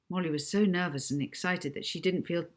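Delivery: ordinary delivery, nothing marked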